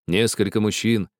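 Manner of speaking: plain reading voice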